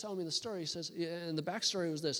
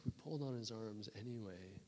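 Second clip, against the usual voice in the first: hushed awe; 6 semitones lower, 10 dB quieter than usual. Retold or imitated. Awed retelling of the healing.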